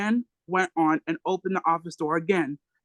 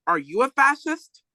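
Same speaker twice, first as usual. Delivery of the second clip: higher, louder comeback question; 7 semitones higher than usual. Retold or imitated. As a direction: fire it back, topping the cop. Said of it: "Then Huey got louder".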